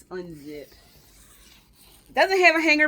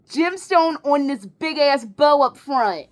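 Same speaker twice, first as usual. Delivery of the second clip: ordinary delivery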